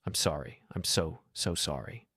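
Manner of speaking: a soft, understated sob of an apology; tearful apology. A narrator's reading. Ex-wife apologizing to him through tears, soft and teary. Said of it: "She started crying again".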